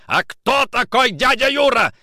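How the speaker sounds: loud, high yelled question; angry shout. A narrator's reading. Nikitin interrogating Orlov, angry shout. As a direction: shout it full out at him. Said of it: "закричал Никитин".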